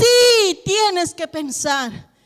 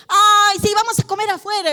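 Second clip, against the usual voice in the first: put-on child begging parents to eat out, excited; excited kid; 5 semitones higher than usual. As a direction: beg to go out like a thrilled child.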